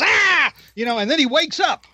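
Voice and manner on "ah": short high mock yelp; a brief hammed-up startled scream. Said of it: "and he goes".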